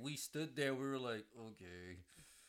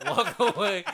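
laughing through the words, slow and much louder; laughing repeat; 10 semitones higher, 15 dB louder, slower than usual. Retold or imitated. Laughing while retelling Taylor's line to friends.

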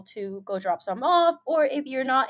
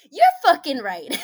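louder emphatic agreement; 4 semitones higher, 9 dB louder than usual. Retold or imitated. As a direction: punch it out fully agreeing.

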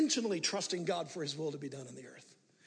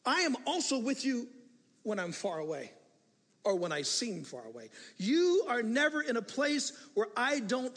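reprimanding God; 6 semitones higher, 3 dB louder than usual. Acted. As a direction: scold gently as the Lord. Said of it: "there's a reprimanding tone here".

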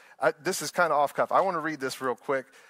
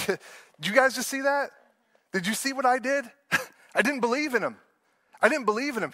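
higher-pitched, acted disbelief at himself; Peter; 6 semitones higher than usual. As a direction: play a doubter confessing, pitched up. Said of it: "How easy would it have been for Peter".

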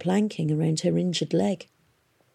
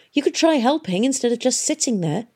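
a touch of irritated retort, bit higher; mildly cross at idle Hester; snapped; 6 semitones higher than usual. A narrator's reading. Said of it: "Tom snapped back".

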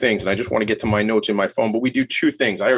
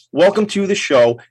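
higher, louder showman welcome; announcer welcome; 8 semitones higher, 5 dB louder than usual. Acted. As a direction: greet the guest like an emcee. Welcoming guest, announcer style.

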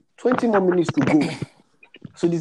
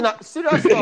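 exclaimed retort; 13 semitones higher, 5 dB louder than usual. Retold or imitated. Invigilator's exasperated reply retold.

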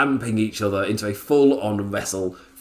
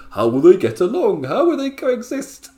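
high, loud melodramatic promo; mock dramatic; 10 semitones higher, 5 dB louder than usual. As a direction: overplay the worried question. Mocking storyline cliché.

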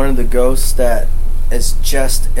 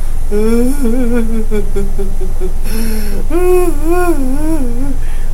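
high, wailing mock sob; imitated ugly crying; 10 semitones higher than usual. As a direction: mimic a ghost's ugly crying. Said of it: "She cries ugly".

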